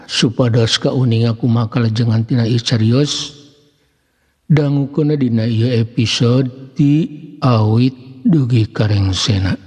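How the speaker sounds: ordinary talk, nothing marked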